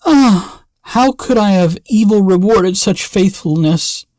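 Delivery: high, aching cry of grief; a king wailing his regret; grieving lament. A narrator's reading. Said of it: "had great grief because of this".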